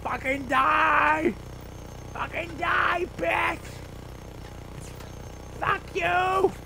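high, furious cursing yells; raging yell. Real, not acted. Gamer shooting enemies, high furious cursing. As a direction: scream curses at the enemies.

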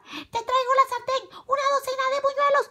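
put-on very high, hurried girlish yell; girl's shout. A narrator's reading. Carmela announcing what she brings, high yell. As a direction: yell the delivery list high. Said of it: "volvió a gritar".

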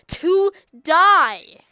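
loud battle yell. Acted. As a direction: shout the last one out. Attacking VR character.

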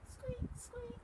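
tiny high-pitched squeaks; lantern squeak. A narrator's reading. Reader mimics lantern swinging in the dark, squeaky. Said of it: "went the lanterns".

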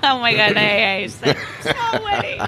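amused protest, breaking into laughs; laughing at being teased. Real, not acted. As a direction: talk through real laughter. Laughs at being teased about small wedding.

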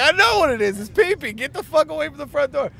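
high-pitched exasperated yell; retold yelling. Teller yelling at roommate to leave the door, exasperated. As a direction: yell it at the top of your range. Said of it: "I'm yelling at him".

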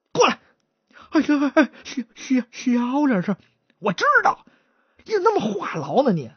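brisk, dismissive partner, a hint of contempt; lightly voiced chatterbox. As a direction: chatterbox cuts off the stutterer, dismissive.

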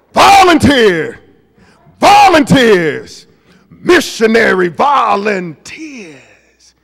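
loud, drawn-out rallying call; preacher's ringing shout, stretched long. Acted. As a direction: boom each word out slowly to the congregation. Calls out old youth group name.

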